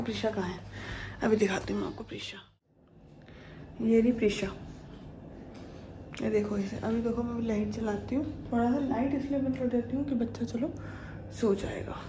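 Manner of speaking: quiet whisper by the crib; hushed whisper. Real, not acted. Showing sleeping kids at night.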